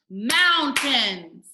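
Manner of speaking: "mountains" split loudly into moun-tains; syllable by syllable. Shown as a demonstration. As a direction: beat out both syllables of "mountains". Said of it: "syllables where you clap for the word".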